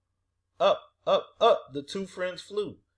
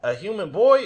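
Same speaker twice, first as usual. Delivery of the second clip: loud, higher wail of a probe; 5 semitones higher, 9 dB louder than usual. A narrator's reading. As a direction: wail it in mild dismay. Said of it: "it wailed".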